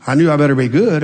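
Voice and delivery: ordinary delivery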